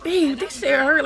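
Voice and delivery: put-on slow, high, whiny plea to the boyfriend; almost crying; pained plea; near tears. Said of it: "Like, I'm about to cry".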